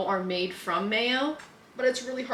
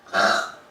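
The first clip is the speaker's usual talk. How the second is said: loud burp; 6 dB louder than usual. Real, not acted. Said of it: "Excuse me".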